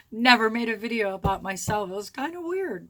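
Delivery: ordinary delivery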